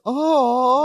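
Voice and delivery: high, drawn-out sympathetic "aww"; audience aww. Retold or imitated. Audience reacting to a kind gesture, sympathetic aww. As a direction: imitate a studio audience cooing. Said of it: "the whole audience goes".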